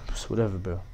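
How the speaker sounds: low, soft mumbled brush-off; imitated meek dismissal. Kid who was just spat on, imitated soft brush-off. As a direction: play the kid backing down, quietly. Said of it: "he was just like this".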